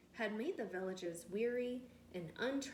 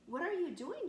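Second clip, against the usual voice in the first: put-on high, louder little girl's question; 8 semitones higher, 4 dB louder than usual. A narrator's reading. Teacher voicing the little girl, high and curious. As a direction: ask like a curious brave child.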